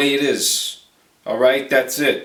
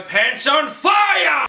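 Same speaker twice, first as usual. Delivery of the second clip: much higher, slow shout; taunting shout; 12 semitones higher, much slower than usual. Retold or imitated. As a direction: yell the taunt.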